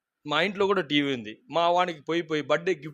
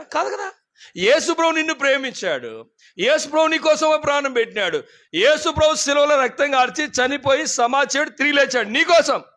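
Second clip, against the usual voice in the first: higher, louder proclaiming; fervent preaching; 9 semitones higher, 5 dB louder than usual. Acted. Preacher calling listeners to Jesus, fervent loud preaching.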